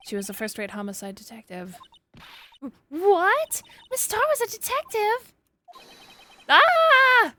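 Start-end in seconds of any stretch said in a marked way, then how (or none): 2.9-7.3 shocked. Acted. Courtroom shock at reveal.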